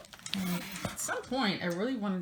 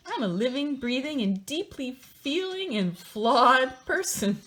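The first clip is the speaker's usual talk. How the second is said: mock heartfelt reading; 6 semitones higher, 6 dB louder than usual.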